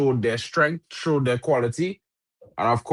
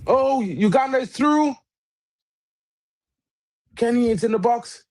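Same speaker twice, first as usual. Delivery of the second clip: sudden high shout at the news; excited shout; 9 semitones higher than usual. Real, not acted. Co-host watching live, shouting the news. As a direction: call out the result, thrilled.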